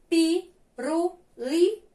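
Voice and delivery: slow "pirulito", split into syllables; syllable-by-syllable reading. Shown as a demonstration. Teacher reading the word to pupils, syllable by syllable. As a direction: read "pirulito" slowly, a gap after each syllable. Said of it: "eu vou ler a palavra".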